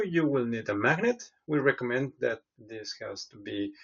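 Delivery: ordinary talk, nothing marked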